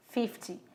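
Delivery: "fifty" with stressed "fif", falling away; "fifty", short unstressed "ty" at the end; stress on the first syllable. Shown as a demonstration. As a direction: lean on the front of "fifty". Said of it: "a sílaba tônica tá no começo".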